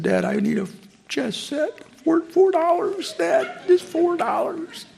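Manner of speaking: put-on high, small, pleading child voice, slowing at the end; sheepish little voice. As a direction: beg shyly like a little boy. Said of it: "my sheepish little voice said".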